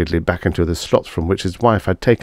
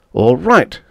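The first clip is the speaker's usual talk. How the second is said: raised, louder angry concession; 8 semitones higher, 5 dB louder than usual. A narrator's reading. Martin gives up and heads for the door, angry. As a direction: give up crossly. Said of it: "starting for the door angrily".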